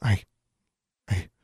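halting, whispered stammer; breathless stammer. A narrator's reading. Shocked mother answering her daughter, breathless stammer. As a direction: try and fail to get words out. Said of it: "trying to stammer something".

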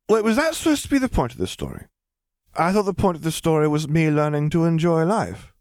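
put-on high, indignant girl voice. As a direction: protest like a little girl. Lily protests.

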